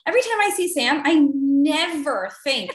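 ordinary delivery

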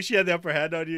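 ordinary talk

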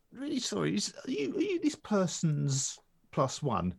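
high, hesitant doorman asking politely; apologetic doorman. Retold or imitated. Retelling the doorman at the door, high and apologetic. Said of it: "He says".

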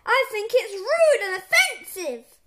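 high, loud indignation; angry yell. A narrator's reading. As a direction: rail at the boys in outrage. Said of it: "yelled Mister Crump".

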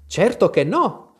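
quick-off-the-mark, high, loud reply; prompt reply. A narrator's reading. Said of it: "replicò pronta Alice". (risposta pronta)

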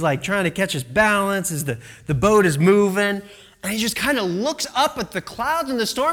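ordinary talk, nothing marked

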